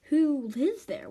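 usual speaking voice

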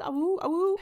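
high dog-like barks and howls; wolf voice. Shown as a demonstration. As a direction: bark and howl like a little dog. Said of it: "I'm barking like a dog a little bit".

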